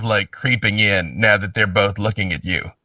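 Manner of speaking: ordinary delivery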